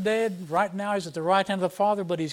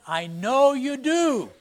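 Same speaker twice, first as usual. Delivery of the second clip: "I know you do" slow and emphatic, higher and louder; pressing; 5 semitones higher, 4 dB louder, slower than usual. Retold or imitated. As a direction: press the king with slow insistence. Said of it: "really putting the pressure on".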